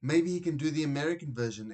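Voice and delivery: mother exclaiming, fast and eager, to the father; excited exclamation. A narrator's reading. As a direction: blurt out the big dream. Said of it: "exclaimed Mum".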